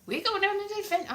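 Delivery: high, quick mock-Welsh voice; Ivor the Engine. Comic voicing the engine setting out, high mock Welsh. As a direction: chirp it in a bad Welsh lilt. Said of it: "except terrible accents".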